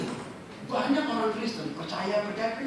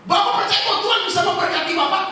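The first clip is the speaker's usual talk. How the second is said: high, loud pointed question; 10 semitones higher, 9 dB louder, faster than usual. Retold or imitated. Pastor confronting a churchgoer, loud demanding question. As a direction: press him hard on where Jesus is.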